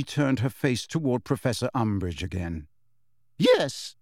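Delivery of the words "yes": high, exasperated response; tight, high-pitched exasperation; strained patience. A narrator's reading. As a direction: answer the interrupter with forced patience. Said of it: "as though praying for patience".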